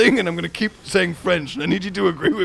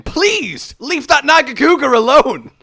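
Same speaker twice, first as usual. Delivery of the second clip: mock pleading; 6 semitones higher, 4 dB louder than usual. Pleading with teammate for the monster, mock-desperate. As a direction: beg them to spare it.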